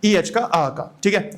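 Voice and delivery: ordinary talk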